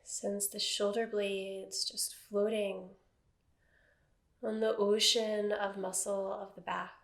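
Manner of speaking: calm, even meditation voice with long silences; guided meditation. Acted. Teacher settling a seated class into body awareness, guided meditation. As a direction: guide them gently into stillness, phrase by phrase.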